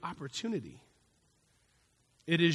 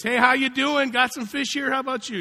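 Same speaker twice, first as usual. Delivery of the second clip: high, loud, bright greeting; cheery call; 8 semitones higher, 10 dB louder than usual. Retold or imitated. Fisherman calling on the radio, overly cheerful. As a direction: sing out a friendly hello.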